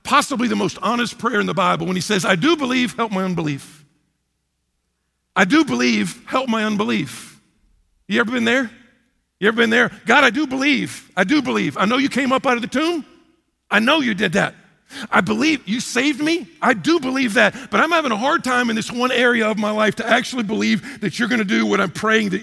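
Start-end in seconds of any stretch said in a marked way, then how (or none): 2.2-7.4 a desperate father crying out his honest prayer to Jesus. Acted. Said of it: "the Father cries out". 10.1-22.4 a struggling believer pleading honestly with God; desperate prayer. Acted.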